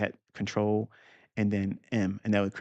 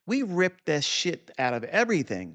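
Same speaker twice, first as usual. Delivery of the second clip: ordinary talk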